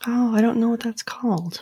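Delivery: unvoiced whisper; whispered aside. Real, not acted. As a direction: drop to a hush. Both drop to whisper.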